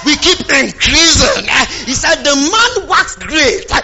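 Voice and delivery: rising, forceful pulpit delivery; fired-up preaching. Real, not acted. Preacher telling congregation of growing greatness, fired up. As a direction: build it phrase by phrase for the congregation.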